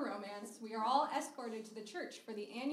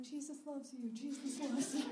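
camp counselor; 3 semitones higher than usual. Retold or imitated. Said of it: "camp counselors going around just being like".

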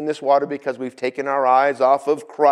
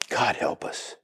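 breathy whispered prayer; hushed whisper of dismay; whispered aside; 4 semitones lower, 8 dB quieter, slower than usual. Real, not acted. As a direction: whisper it under your breath. Aside at envy.

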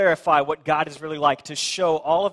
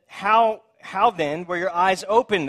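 demanding; 5 semitones higher, 6 dB louder, slower than usual. Acted. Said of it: "they demanded".

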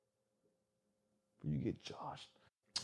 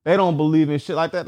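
ordinary delivery

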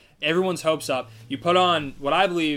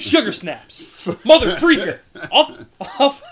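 loud, high, hammed-up fake swearing; exaggerated outburst, half laughing; mock clean cursing; 9 semitones higher, 9 dB louder, slower than usual. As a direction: belt out silly substitute curses. Mock clean cursing after scolding.

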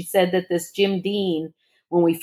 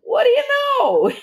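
surprised. Retold or imitated. Telling husband shocking news.

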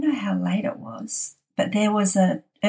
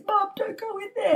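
retold scream; 13 semitones higher, 4 dB quieter than usual. Daughter begging mum from the top of the stairs, high mock scream. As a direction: imitate the daughter's frightened cry from the stairs, high and thin. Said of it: "she's screaming at me".

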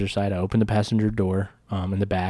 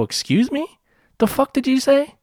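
high-pitched, incredulous retold challenge; indignant disbelief; 3 dB louder than usual. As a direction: re-enact being outraged by an insult. Retold indignant reply to an insult.

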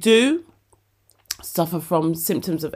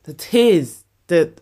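emotional; 3 semitones higher, 8 dB louder, much slower than usual. Real, not acted. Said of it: "already been emotional".

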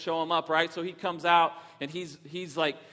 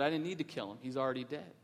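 quiet wisdom; 3 semitones lower, 8 dB quieter, faster than usual. Retold or imitated. Miyagi's quiet wise reply.

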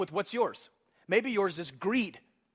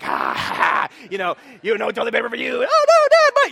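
mock evil dad and whining son; 2 semitones higher, 10 dB louder than usual. Pastor mocking a cruel dad teasing his son. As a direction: play the mean dad, then the wailing kid. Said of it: "that has never happened".

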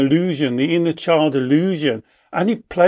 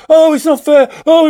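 put-on high, loud whining child voice; 11 semitones higher, 8 dB louder than usual. Child refused a biscuit, wailing that it's not fair. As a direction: wail it like a sulking kid denied a treat.

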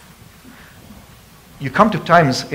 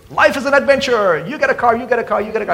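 high, mock talk-show hostess; Oprah impression; 9 semitones higher than usual. Preacher mimicking Oprah to the church, high and hammed-up. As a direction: ham up Oprah's giveaway. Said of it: "you can even hear Oprah Winfrey say".

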